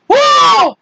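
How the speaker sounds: high, loud startled whoa; yell. Acted. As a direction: yell as you tumble. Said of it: "and yells".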